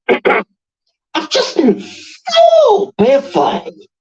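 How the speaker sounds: very high, drawn-out Yorkshire voice; hammed-up slow Yorkshire squeal; Yorkshire impression. Streamer imitating a funny woman on Channel four, Yorkshire accent. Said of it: "she's from the place I don't like, Yorkshire, and she goes".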